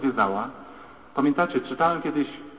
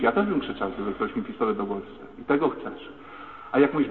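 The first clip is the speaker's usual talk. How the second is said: ordinary talk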